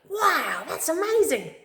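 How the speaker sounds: high, wide-eyed wonder; amazed exclamation. Shown as a demonstration. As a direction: gush with delight. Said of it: "It's like".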